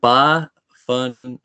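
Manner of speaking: BAFANGU very slow, syllable by syllable; "Bafangu" stretched into separate syllables; teaching demo. Shown as a demonstration. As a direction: draw out "Bafangu" slowly for a learner. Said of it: "I could teach you a word".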